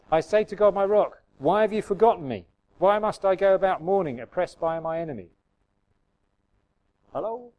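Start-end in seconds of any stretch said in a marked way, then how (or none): 0.1-5.3 loud, high scripture reading; psalm reading. Acted. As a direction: read out a complaint to God, raised voice. Reads psalm aloud.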